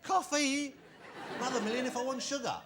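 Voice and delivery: high, loud mock indignation; hammed-up shocked tourist; mock outrage. Mocking tourist shocked by prices.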